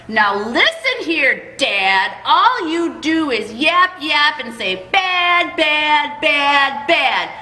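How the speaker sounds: the Onceler shouting down the Lorax; yelling Onceler. Acted. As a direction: yell back at him, stubborn and defiant. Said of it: "I yelled at that Lorax".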